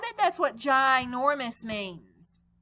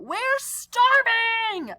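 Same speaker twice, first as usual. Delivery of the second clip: high, slow, louder yell of hunger; hungry yell; 11 semitones higher, 6 dB louder, much slower than usual. Acted. Hungry friends yelling at Clark, high and loud. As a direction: wail it out, dragging starving. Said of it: "they're yelling it".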